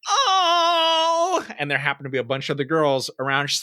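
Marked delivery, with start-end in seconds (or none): 0.1-1.5 long, high-pitched cooing cry; drawn-out high squeal; mock girlish "oh". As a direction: imitate a girl's exaggerated reaction. Imitates the girl's loud reaction.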